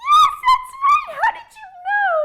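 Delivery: very high, loud cry; high excited cry; thrilled. Acted. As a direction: squeal with delight. Excited surprise.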